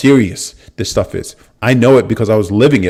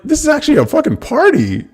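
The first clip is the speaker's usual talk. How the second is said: much higher, thrilled exclamation; excited amazement. Retold or imitated. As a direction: squeal it with delight. Excited discovery at rally.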